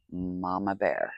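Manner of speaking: "Mama Bear" said softly and slowly, drawn out; "Mama Bear" hushed and slowed; quiet slow repeat. Real, not acted. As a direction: murmur Mama Bear again, sinking into it.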